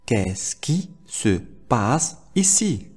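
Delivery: very slow teaching model; slow, in chunks. Shown as a demonstration. Teacher models a French question for learners, slow and broken up. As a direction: separate the question into slow pieces.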